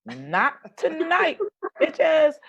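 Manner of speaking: high, slow and laughing; sassy catchphrase. Real, not acted. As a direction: sing it out cheekily.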